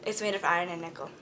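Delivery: rushed low-volume retake of the line; quick quiet aside. Shown as a demonstration. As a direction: toss it off under your breath.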